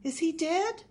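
high, alarmed question; shocked gasp. A narrator's reading. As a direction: ask it aghast, pitch jumping up. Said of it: "the burglar gasped".

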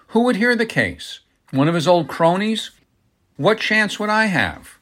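high-pitched, indignant rhetorical questions; incredulous protest. A narrator's reading. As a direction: protest the absurdity, pitch soaring. Incredulous protest against suing a judge.

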